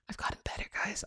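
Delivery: breathy giggling whisper, barely voiced; laughing whisper. Real, not acted. Confessing her progress to listeners, laughing whisper.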